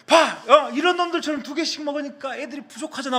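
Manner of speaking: very high mock yell, loud at the start; angry coach scolding. Coach blaming a student for the food shortage, acted anger. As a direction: imitate a furious coach berating a culprit. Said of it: "제 뒤에서 화를 내시더라고요".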